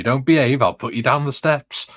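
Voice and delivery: loud, high mock threat from nan; imitating grandma scolding the kids; nan's threat. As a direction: boom it out like a stern nan.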